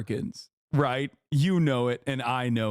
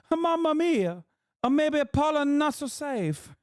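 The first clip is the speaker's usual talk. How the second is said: much higher hammed-up Italian accent; cartoon Italian, Mamma mia; mock Italian accent; 11 semitones higher than usual. Streamer imitates the tourist in a higher hammed-up Italian accent. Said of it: "Now I'm saying".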